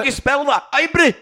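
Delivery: high, loud mock Scottish voice. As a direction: ham up a Scottish accent saying the brand. Mocking Scottish brand name.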